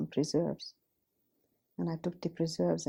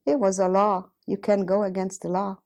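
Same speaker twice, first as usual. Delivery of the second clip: louder, insistent quoted reply; retold forceful retort; 2 semitones higher, 9 dB louder than usual. Man defending himself to the accuser he failed to hide, forceful. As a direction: push the words out loudly, as his excuse.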